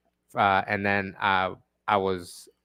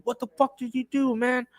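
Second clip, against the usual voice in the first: angry manager; 12 semitones higher, 3 dB quieter than usual. Retold or imitated. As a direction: play the manager blowing up over the ruined car. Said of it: "he goes".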